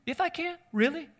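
high-pitched, incredulous "really, dude?"; mock offence, voice jumping way up in disbelief; affronted fleshly response. Preacher imagines Jesus responding like himself, offended and incredulous. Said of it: "He's got a fleshly response".

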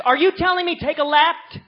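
mock loud puzzled shout; loud confused question. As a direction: yell it in confusion. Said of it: "Paige says".

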